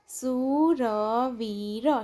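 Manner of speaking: સુરવીર very slow, every syllable held long; drawn-out સુરવીર, syllables separated. Shown as a demonstration. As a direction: sound out સુરવીર slowly for beginner readers. Syllable-by-syllable reading, then whole word.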